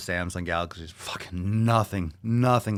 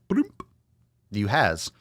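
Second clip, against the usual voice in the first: mock broken-English accent. AirDrop voiced as a goofy broken-English offer. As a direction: play AirDrop as a goofy foreigner offering files. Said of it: "With that accent".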